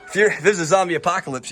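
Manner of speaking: laughing. Real, not acted. Joking about zombie apocalypse.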